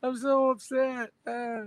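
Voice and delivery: high, slow mock whine; hammed-up crybaby whine; mock crying. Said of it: "You know what I mean?".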